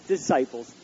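ordinary talk

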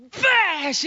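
very high, loud smashing cry; explosive shout. Retold or imitated. Husband smashing a stick on a tree, explosive shout. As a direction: burst out on the word with the blow.

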